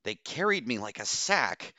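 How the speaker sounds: high, agitated complaint; agitated squeak. A narrator's reading. Woman tells rescuers how she was carried off, agitated squeak. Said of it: "hushed, squeaked with agitation".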